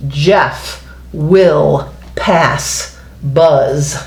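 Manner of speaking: very slow, word by word; slow read-aloud. Shown as a demonstration. Teacher reading a sentence to young pupils, very slow and word by word. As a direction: sound out each word slowly for the class. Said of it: "it says".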